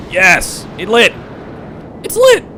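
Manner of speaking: high, loud burst of triumph; elated shout. Real, not acted. Streamer cheering as the stage lights, elated shout. Stage ignites after countdown.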